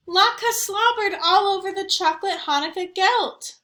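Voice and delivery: put-on tattling girl. A narrator's reading.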